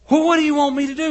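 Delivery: high, loud and fast flustered question. Acted. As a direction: blurt it out in a rush. Moses, overwhelmed, asks God.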